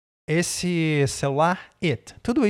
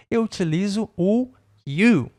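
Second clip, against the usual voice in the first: normal talking voice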